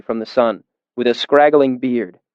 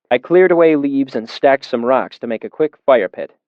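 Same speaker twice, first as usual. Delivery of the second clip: ordinary talk, nothing marked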